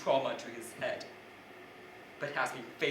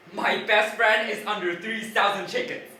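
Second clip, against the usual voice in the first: loud, high frantic protest; raised-voice distraught plea; desperate yelling; 6 semitones higher, 9 dB louder than usual. Retold or imitated. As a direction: shout it over a din in disbelief. Retold desperate plea to PR lady.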